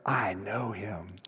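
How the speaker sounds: low, slow, soft confiding aside; Buddy the Elf. Retold or imitated. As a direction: lean in and confide it like a proud secret. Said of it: "and Buddy leans in and he's like".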